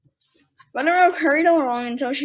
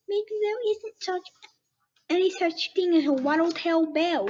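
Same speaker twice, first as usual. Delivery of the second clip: squeaky little bunny asking, higher pitch; 6 semitones higher than usual. Acted. Bunny questions the skunk, high and curious. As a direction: play a small excited bunny, pitched up. Buttermilk thinks aloud.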